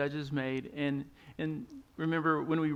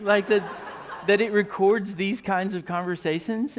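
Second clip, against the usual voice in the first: laughing; 4 semitones higher, 8 dB louder than usual. Real, not acted.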